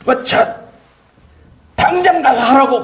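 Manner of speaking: high, slow mock shout of an angry pastor; a pastor yelling at a young helper mid-service, imitated high shout. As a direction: bellow the order from the pulpit, hammed-up. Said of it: "소리 지르시더라고요".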